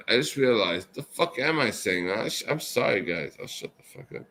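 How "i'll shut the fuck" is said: sheepish whisper, trailing away. Real, not acted. Embarrassed drunk promising to shut up, whispering. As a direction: fade to a whisper as you back down.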